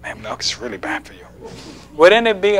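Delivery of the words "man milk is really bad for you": soft whispered warning. Acted. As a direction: confide it under your breath to a friend. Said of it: "came up to you and whispered to you".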